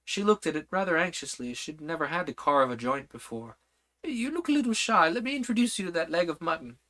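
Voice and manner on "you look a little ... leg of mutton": Red Queen. Acted. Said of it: "said the Red Queen".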